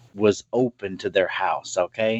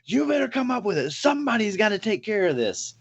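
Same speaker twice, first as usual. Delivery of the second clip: raised, higher voice of an irate dad; insistent acted demand, pitched up; putting foot down; 9 semitones higher than usual. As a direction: imitate a dad laying down the law. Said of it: "putting his foot down".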